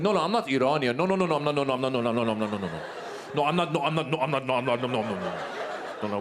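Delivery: comic Iranian guy backpedalling; defensive Iranian guy. Retold or imitated. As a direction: protest over and over, sinking into babble. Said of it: "it's always the guy who's like".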